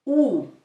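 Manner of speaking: pure U like in moon; Spanish U. Shown as a demonstration. Teacher modelling the Spanish vowel U. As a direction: round lips, short U. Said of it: "The following one".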